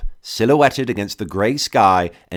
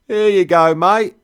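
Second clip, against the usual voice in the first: very high, loud cockney; thick cockney; 10 semitones higher, 7 dB louder than usual. A narrator's reading. Trader hands over tea, loud high cockney. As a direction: hand the mug over like a loud cockney host. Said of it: "cockney thick as fog on the Thames".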